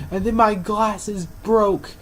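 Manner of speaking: retold indignation, words drawn out; really angry. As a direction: imitate an aggrieved patron. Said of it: "really angry".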